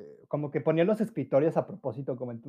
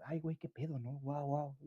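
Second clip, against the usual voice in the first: mock scared; 12 dB quieter than usual. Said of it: "como espantado".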